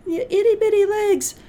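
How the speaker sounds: put-on high cooing baby talk; cutesy voice. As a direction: coo it like over a kitten. Cooing over tiny cat legs.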